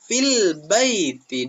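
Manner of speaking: teacher repeats the answer for pupils, slow; slow model phrase. Shown as a demonstration.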